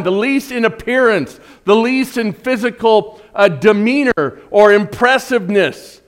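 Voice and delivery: loud, high-pitched pulpit storytelling; full-force preaching. Real, not acted.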